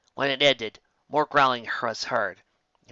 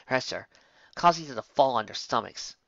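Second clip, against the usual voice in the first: ordinary delivery, nothing marked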